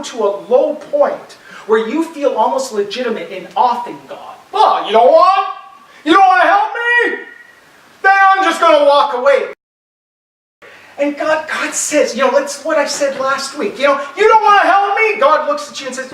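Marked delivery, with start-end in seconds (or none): 4.5-9.5 high, loud petulant huff; sulky believer. Retold or imitated. Preacher voicing a believer walking away from God, high and huffy. 14.2-15.2 sulky believer. Retold or imitated. Believer sulking at God.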